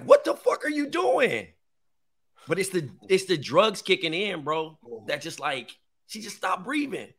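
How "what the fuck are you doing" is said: high, slow, alarmed shout of panic; panicked cry. Retold or imitated. Son panicking as mom stops breathing, high alarmed cry. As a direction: cry out in fright, high and drawn out. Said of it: "And I'm like".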